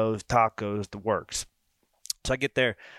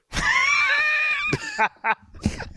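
burst of laughter. Real, not acted. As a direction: break into a shrieking laugh. Realizes he ate another's order.

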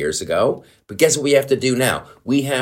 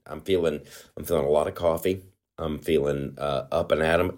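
quiet jittery; 6 semitones lower, 8 dB quieter, faster than usual. Real, not acted. Coffee jitters, voice low.

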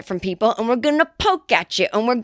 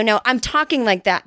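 usual speaking voice

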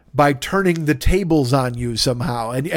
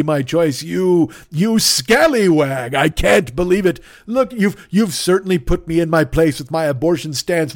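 mock-theatrical villain; 2 semitones higher than usual. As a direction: play a defeated melodramatic villain, slow. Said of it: "I'm like".

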